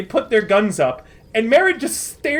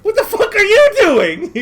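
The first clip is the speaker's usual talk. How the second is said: laughing, much higher and louder outburst; shocked outburst; 9 semitones higher, 6 dB louder than usual. Acted. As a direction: blurt it out in disbelief, laughing. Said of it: "Merritt says".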